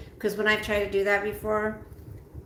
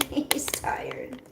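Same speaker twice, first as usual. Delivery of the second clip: whispering; 4 semitones higher than usual. Real, not acted. Whispered aside about dog.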